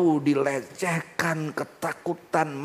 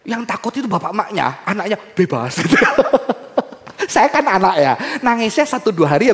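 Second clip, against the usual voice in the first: louder, laughing sermon aside; lively preaching; 6 semitones higher, 9 dB louder than usual. Acted. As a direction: tease the congregation.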